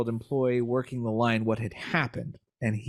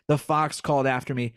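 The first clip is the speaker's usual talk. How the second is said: usual speaking voice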